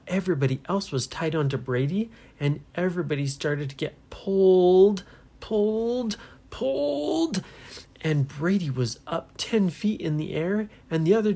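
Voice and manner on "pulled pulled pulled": drawn-out word climbing much higher; rising strain. A narrator's reading. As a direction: stretch it upward as if being dragged. Acting out being dragged upward.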